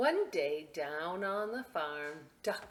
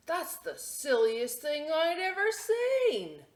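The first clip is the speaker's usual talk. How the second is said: cow's scoffing thought; 11 semitones higher, 8 dB louder, slower than usual. Acted. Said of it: "what she thought was".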